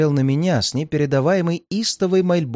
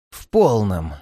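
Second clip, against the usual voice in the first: sinister smile; 5 semitones lower, slower than usual. A narrator's reading. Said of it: "со зловещей улыбкой ответил я".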